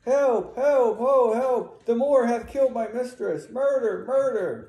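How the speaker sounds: high, loud cries of alarm; yelling for help. Acted. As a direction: raise the alarm out the door. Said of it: "Amelia is yelling out the door".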